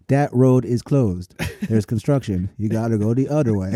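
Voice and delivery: a lightly done Canadian apology voice; mock Canadian accent. Imitating a Canadian giving road directions, lightly accented. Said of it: "a real conversation I had with a Canadian".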